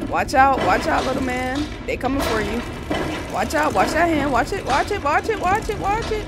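frantic warnings climbing in pitch; urgent warning. Real, not acted. Player warning little man of danger, urgent and climbing.

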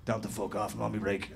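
low, soft grumbling civil servant lion; grumpy lion. Retold or imitated. Lion brushing off the visitors, low mock grumble. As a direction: grumble it low like a lazy worker.

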